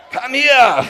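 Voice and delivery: loud, high, laughing; hammed-up heroic summons; laughing mock superhero call. Superhero team-up joke.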